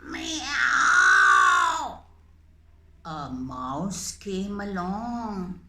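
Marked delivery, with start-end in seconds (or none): 0.0-2.0 loud, low drawn-out yowling meow; hungry cat meow. Acted. Teacher voicing the hungry cat puppet, loud low meows. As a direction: wail like a starving cat.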